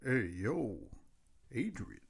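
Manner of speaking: low, very soft boxer voice; a quiet, deep call with Adrian fairly clearly said; Sylvester Stallone as Rocky. Acted. His turn at the Rocky contest, low soft Stallone voice. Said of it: "your Adrian was a little too clear".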